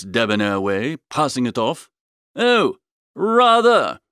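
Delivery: usual speaking voice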